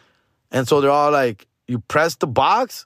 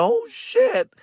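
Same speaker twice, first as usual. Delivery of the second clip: very high-pitched squeaked curse; acted-out falsetto alarm; mock dismay. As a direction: squeal it in helpless disbelief. Replays his dismay.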